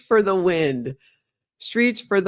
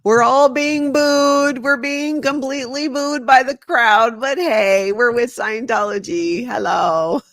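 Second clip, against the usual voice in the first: high, bright, mock-cheerful waving member; cheery Scientologist voice; 7 semitones higher, 4 dB louder than usual. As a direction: play the oblivious smiling cult member.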